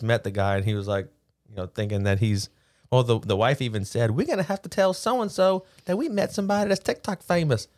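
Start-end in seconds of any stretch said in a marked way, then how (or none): 4.1-7.7 higher-pitched imitation with an Alabama twang; Alabama accent. Wife plans to tell friends, higher Alabama accent. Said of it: "Did I go with that Alabama accent again?".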